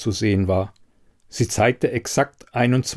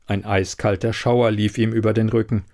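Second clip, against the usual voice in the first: plain reading voice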